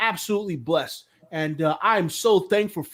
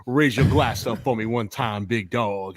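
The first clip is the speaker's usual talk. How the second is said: smooth host voice; 8 semitones lower than usual. Acted. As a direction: lay it on low and smooth like a radio host. Acts smooth radio host voice.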